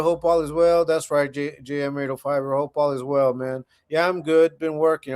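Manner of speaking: ordinary talk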